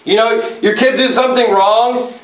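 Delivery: ordinary delivery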